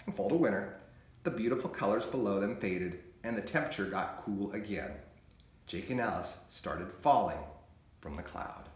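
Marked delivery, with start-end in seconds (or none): 2.4-8.7 soft fading narration. A narrator's reading. Gentle falling snow.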